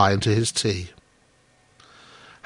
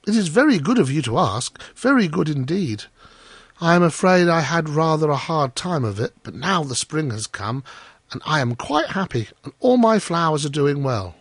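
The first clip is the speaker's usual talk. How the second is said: higher-pitched, grateful little gardener; humble and eager to please; Hans; 6 semitones higher than usual. Acted. Hans telling the miller about his winter, grateful.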